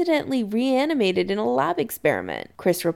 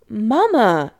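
louder, firm interruption; cutting in; 3 semitones higher, 6 dB louder than usual. A narrator's reading. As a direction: break in on mother's chatter. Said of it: "Chris cuts in".

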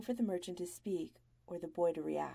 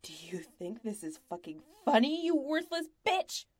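louder, rising into a high yell; fury climbing to a much higher shout; shaking with rage; 4 semitones higher, 6 dB louder than usual. A narrator's reading. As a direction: berate the boy, voice breaking with fury. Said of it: "his voice is shaking when he".

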